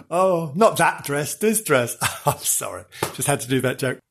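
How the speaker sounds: ordinary talk, nothing marked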